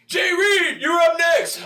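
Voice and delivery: high shouted call; loud high backstage call. Retold or imitated. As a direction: call his name like a stage manager. Announcer calling him up.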